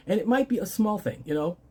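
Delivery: usual speaking voice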